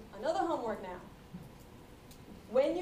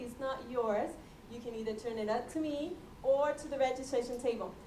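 usual speaking voice

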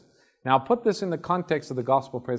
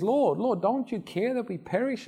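panicked plea; 8 semitones higher than usual. Acted. Disciples panicking in storm.

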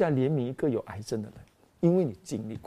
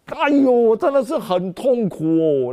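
pained lament; 7 semitones higher, 5 dB louder, slower than usual. Retold or imitated.

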